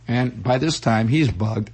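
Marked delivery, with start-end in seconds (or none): none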